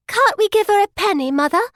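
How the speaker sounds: put-on high, piping rich girl; a lightly voiced child asking her mother; piping little girl. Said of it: "The girl pipes up with".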